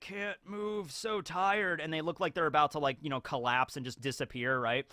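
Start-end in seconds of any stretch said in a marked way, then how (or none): 0.0-1.8 soft, slow, drained mock groan; zombie-like exhaustion. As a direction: drag the words out as if about to collapse. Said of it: "look more zombie-like, and they're just like".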